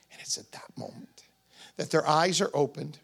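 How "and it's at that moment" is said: hushed whisper of awe. Real, not acted. Preacher reaching the moment of recognition, whispering. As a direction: drop to a breathless whisper. Climax of the Emmaus story.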